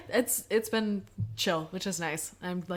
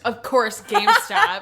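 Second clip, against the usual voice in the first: louder, higher scornful GameStop; exasperated emphasis; 10 semitones higher, 8 dB louder, slower than usual. Shown as a demonstration. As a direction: spit out GameStop in disgust.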